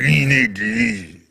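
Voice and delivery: very slow, low official voice; mock authoritative. Host imitates principal ordering a parent, mock authoritative. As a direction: drag it out low, playing the authority figure. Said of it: "he was authoritative with you".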